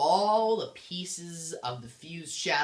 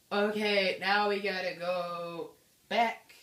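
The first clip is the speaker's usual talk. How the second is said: higher, drawn-out player's complaint; mock resignation; 6 semitones higher, 3 dB louder, slower than usual. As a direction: drag it out, pitched up and put-upon. Player's reluctant reaction acted out.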